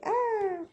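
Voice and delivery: a sudden high little cry of strain; skier's cry. Pretend. The skier struggling uphill, a high mock yelp. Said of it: "my little skier guy, he's like".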